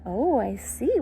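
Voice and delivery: usual speaking voice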